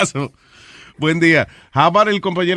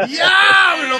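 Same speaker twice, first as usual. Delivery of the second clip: high shouted exclamation; laughing shout of shock; 13 semitones higher than usual. Real, not acted. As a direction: yell it in disbelief. Reacts to funny story.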